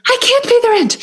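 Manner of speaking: high, far-off squeaky damsel voice; damsel in distress. Acted. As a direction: plead as a helpless melodrama heroine. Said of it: "a victim".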